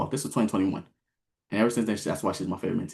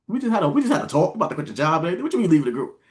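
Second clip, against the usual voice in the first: higher, incredulous objection; retold protest; 5 semitones higher, 4 dB louder than usual. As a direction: replay a baffled protest. Retold protest at her leaving.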